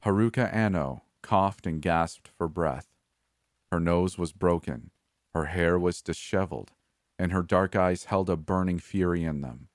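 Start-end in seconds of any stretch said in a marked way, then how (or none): none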